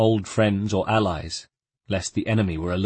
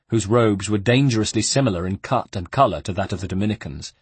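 ordinary delivery

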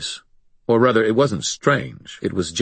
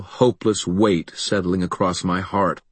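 ordinary delivery

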